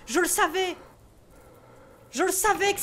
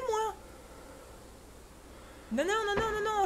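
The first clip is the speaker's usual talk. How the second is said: ordinary talk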